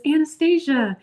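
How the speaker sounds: high, delighted name reveal; happy old woman. Retold or imitated. Traveler imitating old lady who was asked her name, happy. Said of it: "she was so happy".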